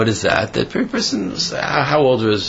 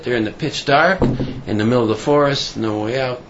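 usual speaking voice

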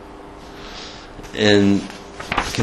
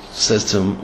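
ordinary delivery

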